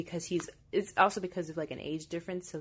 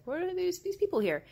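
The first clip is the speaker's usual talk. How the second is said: imitated freak-out; 13 semitones higher than usual. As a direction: mimic a woman freaking out, voice leaping up. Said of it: "Barbara's freaking out".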